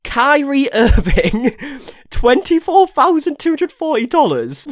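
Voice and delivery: high, loud, slow disbelief; shocked. Real, not acted. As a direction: stretch it out, stunned and laughing. Reads donor name in shock.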